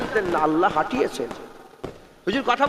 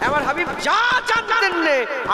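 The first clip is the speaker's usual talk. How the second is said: slow raised preaching; 7 semitones higher, slower than usual. Acted. Preaching climax, drawn out.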